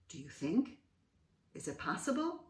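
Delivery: ordinary delivery, nothing marked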